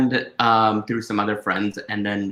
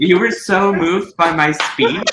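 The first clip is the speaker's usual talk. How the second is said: mock grand; 9 semitones higher, 9 dB louder than usual. Teasing friend who got emotional.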